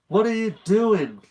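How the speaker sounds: tense, high-pitched protest; urgent hiss. A narrator's reading. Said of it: "Josh hissed".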